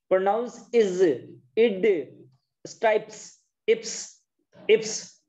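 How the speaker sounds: "is", "id", "ips" cut down to the short i sound, said slowly; vowel sound drill. Shown as a demonstration. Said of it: "ई की मात्रा आ रही है".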